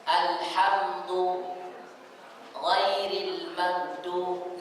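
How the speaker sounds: alhamdu and ghairil magdu drawn out very slowly; slow demo of the mixed-up letters; swapped d demo. Shown as a demonstration. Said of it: "sering terjadi terbalik".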